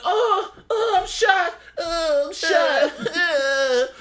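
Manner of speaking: high, drawn-out mock cry of pain; mock wounded wailing. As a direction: wail as if shot, hammed up for laughs. Acting out shot character's pain.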